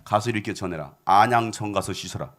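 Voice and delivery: low, lightly voiced master's instructions; prophet giving orders. Prophet sending his servant to the visitor, low command. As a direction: send the servant out, pitched low. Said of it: "한계를 시켜요".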